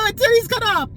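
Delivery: high, loud hammed-up distress; mock panicked wail. Imagined patient panicking in emergency room, hammed-up wail.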